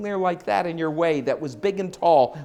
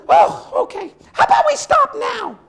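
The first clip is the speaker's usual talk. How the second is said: high, loud eager plea to stop; hopeful horse voice; 7 dB louder than usual. Acted. Horse eagerly asks the storyteller to stop now, high voice. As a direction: beg to quit while ahead.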